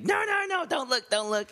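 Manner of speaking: high, loud panicked no no no; frantic shouting. Retold or imitated. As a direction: yell to stop her from seeing the screen. Blocking wife from sad commercial.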